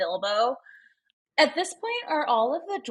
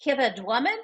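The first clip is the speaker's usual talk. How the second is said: put-on light New Zealand accent, rising on Dwumman; New Zealand teacher accent; 3 semitones higher than usual. Teacher from New Zealand calls roll in a mild accent. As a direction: call the name like a Kiwi teacher with a lilt. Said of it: "she was from New Zealand".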